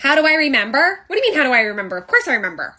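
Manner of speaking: loud, offended retort; loudly affronted; indignant comeback. Acted. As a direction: fire back as if insulted. Restaurant skit, indignant at being questioned.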